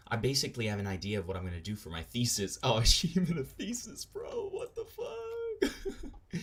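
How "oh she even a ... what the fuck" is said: real laughter pushing the voice very high; incredulous laughing. Real, not acted. Streamer reacting to the thesis text, incredulous laughing. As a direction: squeal it through helpless laughter. Cracks up over the thesis message.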